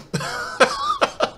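breathless squeaky giggle; real laugh at his own joke; airy high-pitched cackle; high wheezy laugh. Real, not acted. Laughs after saying he condones it.

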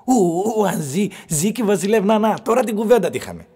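high, loud scoffing outburst; loud exclamation. Acted. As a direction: burst out high and loud, brushing the question off. Mermaid's question answered with loud scoffing. (δυνατό, ψιλό ξέσπασμα)

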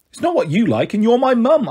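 rising, insistent objection; protesting. Acted. Daughter standing up for her card to mum, protesting. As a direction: push back, climbing high on the second half.

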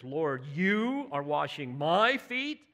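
slow, stressed YOU and MY; emphatic pronouns. Shown as a demonstration. Preacher showing Peter's shock at his Lord, emphatic. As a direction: lean hard on the pronouns you and my. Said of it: "emphatic use of the pronouns".

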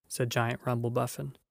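ordinary delivery, nothing marked

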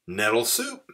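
high-pitched little mouse voice; proud mouse. A narrator's reading. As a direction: present it in a high, pleased pipe. Mouse proudly presents his soup.

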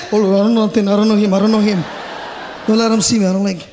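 low, hurried evasive mumble; lightly acted shifty local; evasive denial. Local denying they know the man.

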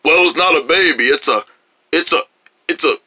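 deep voice, proudly explaining; new low voice. Acted. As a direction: explain yourself in a put-on low voice. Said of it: "I got myself a new baby-sized voice".

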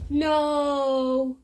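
low, long no; drawn-out no. Acted. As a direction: draw the refusal out low. Rejecting a guess.

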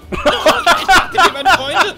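silly dumb pet voice. Acted. Dubbed pet being fed to monster.

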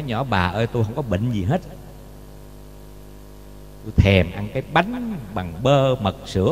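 low, plaintive husband's whisper-free moan; sick husband's plea. Acted. Sick miser husband confides craving.

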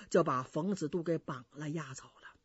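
ordinary delivery

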